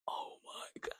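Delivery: breath-only, stunned whisper; whispered panic. Retold or imitated. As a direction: whisper it as if hiding and close to tears. Hiding in closet, retold silent panic.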